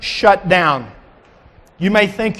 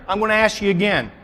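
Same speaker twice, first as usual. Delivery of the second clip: normal talking voice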